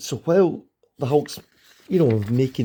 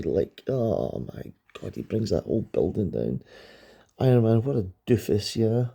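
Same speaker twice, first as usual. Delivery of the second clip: usual speaking voice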